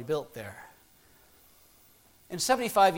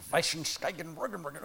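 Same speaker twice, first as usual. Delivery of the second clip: made-up long German word, softer and slow; mock German; 2 semitones lower, 7 dB quieter, slower than usual. Preacher inventing a long German word, slow mock-German mumble. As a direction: mumble through fake German compound. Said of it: "It'd be a very long word".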